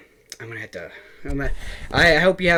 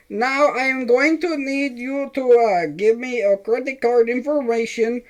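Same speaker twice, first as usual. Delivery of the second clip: much higher mock Indian caller accent; Indian scam caller accent; 12 semitones higher than usual. Retelling a scam call, mocking the Indian caller in a higher accent. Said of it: "like, an Indian guy".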